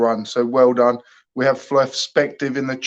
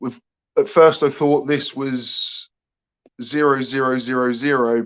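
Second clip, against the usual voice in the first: ordinary talk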